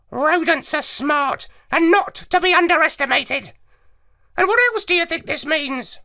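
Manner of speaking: high squawky parrot, explaining; lecturing like a clever parrot. Acted. Parrot lectures the dog narrator, high and squawky.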